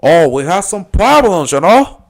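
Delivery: high, loud, drawn-out gangster caricature; hammed-up movie gangster on the phone; Tony from Scarface. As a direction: play it big, high and slow. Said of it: "funny about Scarface".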